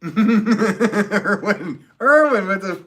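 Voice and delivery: loud, high laughter through the words; laughing hard. Real, not acted. Streamer reacting to a viewer's funny comment, cracking up. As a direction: crack up while saying the name.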